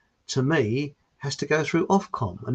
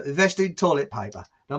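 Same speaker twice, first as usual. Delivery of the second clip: normal talking voice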